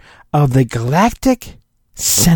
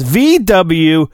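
usual speaking voice

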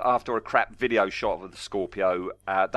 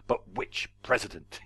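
Avon's delivery; 4 dB quieter than usual. Retold or imitated. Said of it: "Paul Darrow's, uh, delivery of".

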